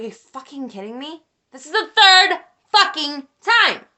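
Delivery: incredulous question exploding into a loud, high yell; reenacted furious rant. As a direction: recreate losing it, building to a full shout. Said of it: "This is what I sounded like in my recording".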